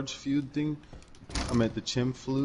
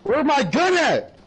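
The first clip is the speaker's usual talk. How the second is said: shouting; 14 semitones higher, 6 dB louder than usual. Real, not acted. Player shouting for gun in game.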